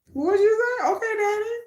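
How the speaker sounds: high, coy pretend flirting; mock swooning. Swooning at the Busan dialect, playfully coy and high. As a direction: answer a crush in a playful little high voice.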